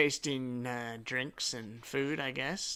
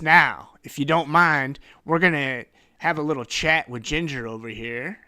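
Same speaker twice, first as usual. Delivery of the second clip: pushy dismissal; 3 semitones higher, 10 dB louder than usual. Acted. Detective dismisses the heroes.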